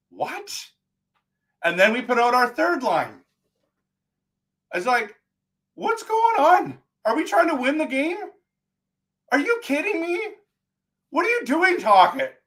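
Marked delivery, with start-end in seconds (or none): 0.1-0.5 baffled. Retold or imitated. Reaction to fourth line. 5.7-12.3 pitched way up in outraged disbelief; disbelieving rant. Retold or imitated. Fan questioning the coach, high and incredulous.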